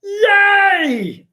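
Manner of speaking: very high, loud mock yay; mock cheer. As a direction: squeal it like a fake cheer. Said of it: "they're like".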